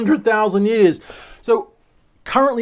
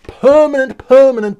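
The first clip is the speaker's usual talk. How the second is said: each permanent punched higher and louder; emphatic repetition; 7 semitones higher, 9 dB louder than usual. Real, not acted. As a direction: hammer the word permanent, rising in excitement.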